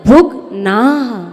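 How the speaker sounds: भुग्नाः with a half ग, visarga breathed at the end; भुग्नाः, half ग and a trailing visarga. Shown as a demonstration. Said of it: "ना पर विसर्ग".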